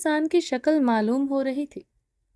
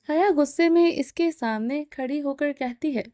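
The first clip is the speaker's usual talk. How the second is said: ordinary delivery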